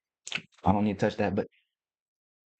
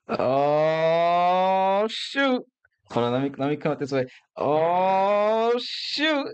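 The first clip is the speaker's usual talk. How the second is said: surprised; 6 semitones higher, 3 dB louder, much slower than usual. Real, not acted. Sudden surprise at the next book.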